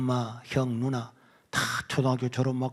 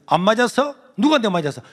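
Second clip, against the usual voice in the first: much louder, higher scolding; angry teacher; 7 semitones higher, 8 dB louder than usual. Retold or imitated. Teacher berating the crying student, angry shout. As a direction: shout it, fed up.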